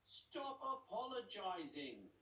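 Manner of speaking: high, slow insistent gull; exasperated seagull. Acted. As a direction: drag it out in exasperation. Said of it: "said Joan".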